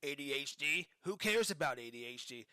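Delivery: low, creepy clown voice; Pennywise voice. Retold or imitated. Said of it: "she did her Pennywise voice".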